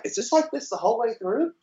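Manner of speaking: curious quote. Retold or imitated. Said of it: "and I'm like".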